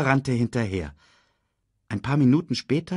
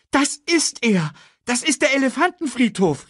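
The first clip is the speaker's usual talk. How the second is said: high, loud cry of discovery; enthusiastic shout; 5 dB louder than usual. A narrator's reading. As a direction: call out in thrilled excitement. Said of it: "Simba war begeistert".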